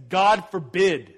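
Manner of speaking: slow, loud "God forbid" with weight; solemn King James. Shown as a demonstration. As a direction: give "God forbid" the gravity of scripture. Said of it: "as the King James says".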